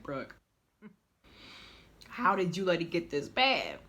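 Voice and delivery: scolding herself through a laugh; mock self-reproach, laughing. Looking at her before photos, she laughs at herself in disbelief. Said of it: "I'm like".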